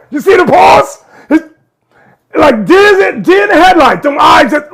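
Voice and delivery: loud, high, amazed commentary; can't believe what he sees; excited. Real, not acted. Host excited by the clip.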